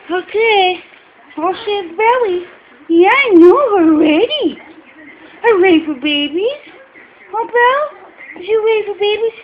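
high, loud cheering for a pet. Real, not acted. Cheering the dog on.

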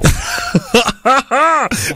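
high burst of laughter; high, rhythmic cackle. Real, not acted. Host laughs at caller lying down.

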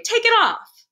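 high, frantic shouted demand; alarmed cry. A narrator's reading. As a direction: shout it, panicking for the boy. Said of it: "I cried".

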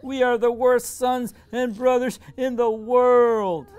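high, loud, slow wail between sobs; a son wailing his guilt, high, loud and slow; gasping sobs. A narrator's reading. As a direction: gasp for air as you cry it. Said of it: "gasps for air between sobs".

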